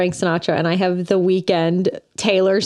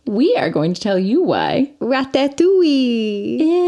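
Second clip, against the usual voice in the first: slow, higher, hammed-up French accent; horrible French accent; 3 semitones higher, much slower than usual. Host announcing the episode topic, horrible French accent. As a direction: draw it out in a bad mock French accent. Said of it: "That was a horrible French accent I just did".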